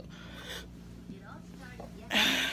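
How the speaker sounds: soft unvoiced sigh. Real, not acted. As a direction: breathe out slowly and wearily. Emotional pause in story.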